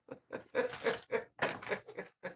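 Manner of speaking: long chuckling laugh; drawn-out string of chuckles. Real, not acted. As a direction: laugh on in a run of short pulses. Own laugh after the joke.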